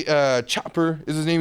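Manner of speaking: ordinary talk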